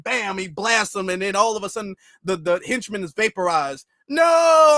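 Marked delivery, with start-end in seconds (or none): 4.1-4.8 loud, high drawn-out cartoon cry; mock death scream. Cartoon henchman being vaporized by the boss, hammed-up shout. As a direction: wail it as a hammed-up villain's victim.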